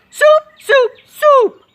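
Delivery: very high, very loud repeated shout; shrieking. Acted. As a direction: scream it over and over. Cook shouts the word.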